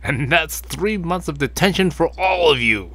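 angry teacher handing out detention. Acted. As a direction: scold the whole class. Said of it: "angry teacher".

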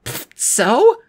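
put-on high, scoffing girl; dismissive girl voice. A narrator's reading. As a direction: scoff at the idea. Annabeth dismissive.

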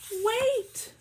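high, loud urgent wait; bear's loud wait. Acted. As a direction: stop everyone with a cry. Bear interrupts.

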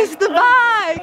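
high, very stretched squeal; thrilled echo. Real, not acted. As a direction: echo the delighted cry, drawing it out. Echoing mum's delight.

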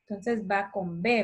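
ordinary delivery

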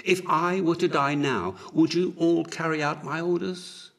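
high, urgent appeal; loud anxious question; crying out. A narrator's reading. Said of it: "cried Horus".

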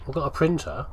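usual speaking voice

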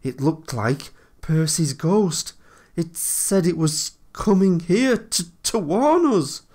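spooked engine, shaky and rising to a loud high finish. A narrator's reading. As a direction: falter through it, pitch climbing with fear. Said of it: "said Toby in a shaky voice".

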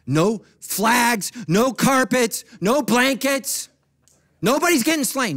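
high, loud, punched list; emphatic preaching. Real, not acted. Preacher reassuring church what won't happen, loud and emphatic. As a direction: hit each item harder, pitched high.

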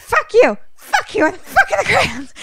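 hysterical high-pitched yelling; freaking out. Retold or imitated. Sawyer panicking on the ward, shrieking for crayons in a high voice. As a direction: shriek it like she's losing her mind. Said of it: "And she's freaking the fuck out".

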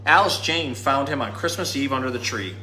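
usual speaking voice

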